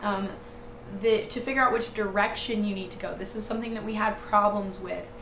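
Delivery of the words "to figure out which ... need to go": ordinary talk, nothing marked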